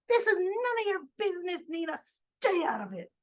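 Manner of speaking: imitating an indignant scolding. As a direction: snap it high, stretching the final warning. Acted telling-off in a retold quarrel.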